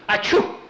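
loud high-pitched acted sneeze; sudden explosive comic sneeze. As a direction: burst out with a big "achoo". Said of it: "he started to sneeze again".

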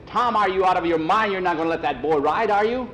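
high, loud, incredulous challenge; alarmed objection. Acted. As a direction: question his sanity with rising urgency. Mister Miller challenges Tom in alarm.

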